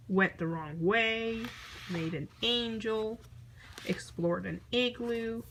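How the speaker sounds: usual speaking voice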